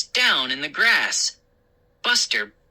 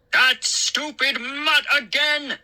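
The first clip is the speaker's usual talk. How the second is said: slow furious shout; angry shout; 10 semitones higher than usual. A narrator's reading. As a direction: shout at the mutt in rage. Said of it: "he shouted".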